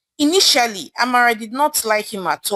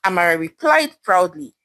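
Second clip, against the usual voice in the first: ordinary delivery, nothing marked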